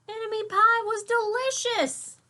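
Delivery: high, loud, amazed reveal; delighted surprise. A narrator's reading. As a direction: burst out with happy surprise. Surprise tasting pie.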